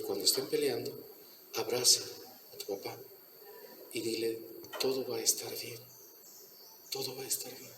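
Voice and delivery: suave, cada vez más bajo, tranquilizador; susurrándole calma a un niño asustado; consejo tierno. Retold or imitated. Maestro aconseja al niño con ternura. (el maestro le aconseja al niño, voz suave y tierna)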